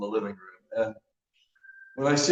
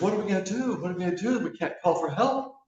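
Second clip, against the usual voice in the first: higher, anxious pleading; nervous guy; 7 semitones higher than usual. Acted. Stranded man panicking to his calm friend, higher and anxious.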